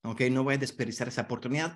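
usual speaking voice

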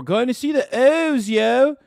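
high, loud mock Baltimore accent; hammed-up Baltimore accent with exaggerated O's. Streamer playing a local fan off to the O's, mock Baltimore accent. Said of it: "one of the most fucked up accents".

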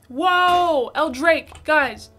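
loud thrilled whoa; excited shout. Real, not acted. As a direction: hype up the top gifter. Big gift arrives on stream.

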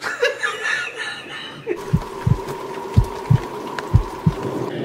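long, high, helpless laugh; real giggling fit. Real, not acted. Reader cracks up over Gladstone leaving, laughing.

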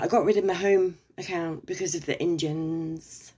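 ordinary delivery

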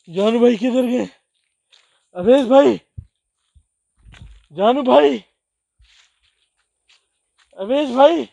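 high, loud wailing calls; panicked distress. Real, not acted. Explorer stranded alone in the forest, panicked shouting. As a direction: cry out for friends who have left you stranded.

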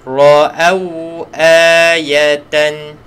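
Ra'au ayatan drawn out, ayatan lengthened; teacher modelling Ra'au ayatan; slow recitation. Shown as a demonstration.

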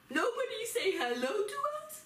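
high, incredulous question; mock disbelief. Teacher playing snubbed traveller for children, mock astonished. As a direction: act hammed-up shock, pitched right up. Acting shocked at no hello.

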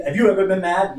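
loud, high mock demand; retold challenge. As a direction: throw the question out like an upset caller. Re-enacting the caller's challenge, loudly.

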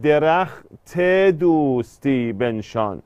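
درخت دوستی بنشان slowly, with the te of درخت drawn out; slow scansion of درخت دوستی بنشان, long te; lengthened vowel. Shown as a demonstration. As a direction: stretch the linking e after درخت. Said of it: "ت رو کشیده".

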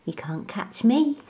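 soft, playful taunt; little monkey teasing in a hush; teasing whisper. A narrator's reading. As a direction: tease a sleeping friend quietly.

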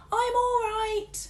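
slow, high, loud call; muffled call. A narrator's reading. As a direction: call out from under the tuba. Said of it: "called Missus Midge from beneath".

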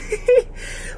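breathless high giggle; squeaky unvoiced giggle; high airy laugh. Real, not acted. Laughs after wanting baby home.

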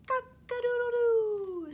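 loud, high crowing cock-a-doodle-doo; big rooster crow. Acted. Rooster sound in story.

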